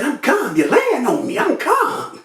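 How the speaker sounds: high-pitched retold protest; protesting calm. Shot man to the nurse lying on him, protesting. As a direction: insist you're calm, voice climbing high.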